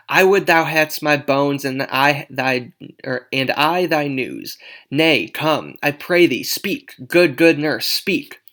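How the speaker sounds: loud, insistent dramatic reading; impatient Juliet. Acted. Juliet begs the tired nurse to speak, insistent and loud.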